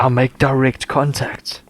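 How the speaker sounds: slow, deadpan dramatic narration; dramatic reading. Acted. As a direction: savour every word slowly. Reading smut aloud.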